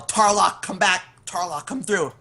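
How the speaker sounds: high, loud calling out; acted yell. Player's character calling Tarlock back, loud high yell. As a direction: yell for someone down a hallway. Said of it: "I'm just gonna yell".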